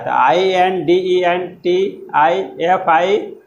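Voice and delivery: slow letter-by-letter spelling of identifiable. Shown as a demonstration. Teacher spelling the word to show the missing letter. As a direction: spell I N D E N T I F I slowly, one letter at a time. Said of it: "यहाँ पर ए छूटा हुआ है".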